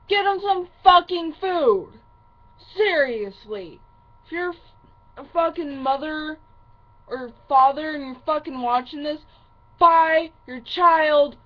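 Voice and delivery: loud, high, furious rant; real angry shouting. Real, not acted. Upset daughter scolding parents who don't feed their kids, angry shouting. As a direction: shout it at them, leaning hard on the key words.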